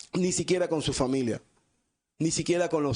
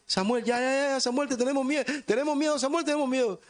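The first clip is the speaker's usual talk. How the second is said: high, pleading mock fear; frightened crowd; 9 semitones higher than usual. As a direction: beg Samuel to stop, scared. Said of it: "el pueblo se escondió". (el pueblo suplicando con miedo fingido, agudo)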